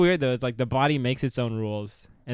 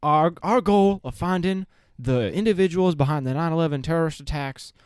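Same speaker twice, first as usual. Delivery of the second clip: George Bush impression; 4 semitones higher than usual. Friend mimics George Bush's press statement. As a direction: play it as George Bush, deadpan then smug. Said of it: "that video of George Bush where he's like".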